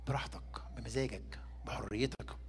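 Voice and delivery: soft whispered reassurance; hushed and unhurried. Real, not acted. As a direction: breathe each word gently. Stressing God's gentleness, whispered.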